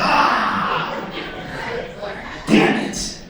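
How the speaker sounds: unvoiced mock yell of frustration; mock frustrated outburst. Driver realising he gave the wrong documents, frustrated. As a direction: act out cursing your own blunder. Acted reaction to his blunder.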